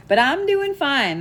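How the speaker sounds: a sweet grandma brushing off worry; grandma's sweet voice. Retold or imitated. Medium reading imitating a sweet grandma who insists she is fine. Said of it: "that's exactly how she said it".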